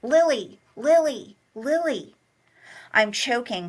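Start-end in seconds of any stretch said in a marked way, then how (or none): 0.0-2.1 higher, louder desperate calls; frantic yelling. Acted. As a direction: yell her name in panic. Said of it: "He's yelling my name so loudly".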